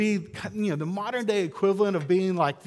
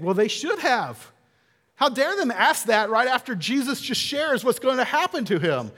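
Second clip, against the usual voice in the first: mock indignation; 7 semitones higher, 5 dB louder than usual. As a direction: play an offended disciple scolding the pair. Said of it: "they would be indignant".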